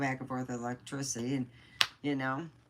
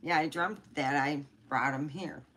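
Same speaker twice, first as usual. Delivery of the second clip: usual speaking voice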